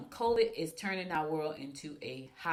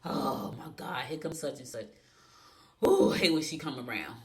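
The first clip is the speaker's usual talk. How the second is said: mock groan; 4 semitones lower than usual. Groaning as a dreaded relative walks in, mock annoyed. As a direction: groan like the relative just walked in. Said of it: "that's what you say".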